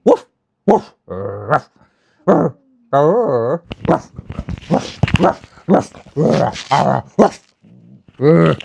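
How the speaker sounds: mock barks, loud; playful loud ruff ruff ruff; dog barking. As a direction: yap along like a dog singing. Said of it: "Come on, sing with me".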